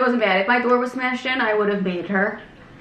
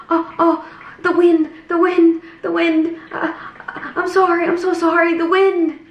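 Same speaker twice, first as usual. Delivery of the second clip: higher, flustered mock Russian-ish accent; imitating heavy accent; 8 semitones higher than usual. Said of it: "she had, like, a heavy accent".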